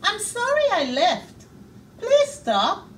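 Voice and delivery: high, loud pleading call after someone. A narrator's reading. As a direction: beg him to stop, calling loudly. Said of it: "called the bear".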